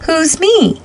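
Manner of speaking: high-pitched, questioning call; mild storybook shout. A narrator's reading. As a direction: call back high, without much extra volume. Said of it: "shouted Santa Claus".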